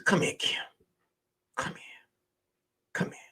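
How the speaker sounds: whispered, repeated coaxing; acted intimate whisper; hushed coaxing. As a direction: draw her in very softly. Coaxing her close, retold.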